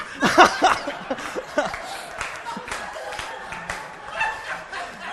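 long, high breathy laughter; real laugh. Real, not acted. Speaker amused by his own story, long real laugh. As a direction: break up at your own punchline.

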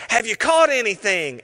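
a mock shout from the shore; calling out. Jesus calling from shore to fishing Simon Peter, mock shout. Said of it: "he yells out".

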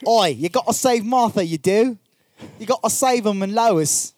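high, loud mock Cockney; Cockney Superman. Friend redoes Superman in a mock Cockney accent. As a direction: belt it like a London street kid. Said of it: "terrible performance on my part".

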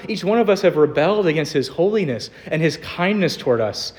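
usual speaking voice

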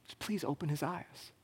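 soft, hushed prayer fading to a whisper; quiet prayer. Retold or imitated. Elisha praying for his servant, soft and hushed. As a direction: pray it softly, almost whispered. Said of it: "He says".

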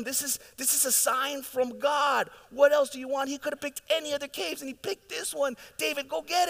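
egging David on to strike, pitch climbing; mock-urgent soldiers hissing at their leader; urging David's men. David's men urging him to kill.

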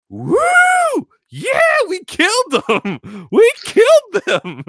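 high, slow, triumphant shouting; gleeful victory yell. Acted. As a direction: scream the win into the popcorn. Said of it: "yelling into the fucking popcorn".

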